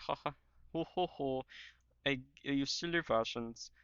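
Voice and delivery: badly faked Austrian, really a German accent; German accent attempting a rounder "ho ho". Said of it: "doing a German accent, but, like, deeper".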